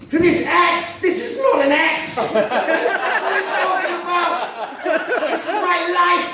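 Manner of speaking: high, loud comic indignation; hammed-up wounded outrage; affronted protest. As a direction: repeat it back as if deeply insulted. Affronted at being called an act.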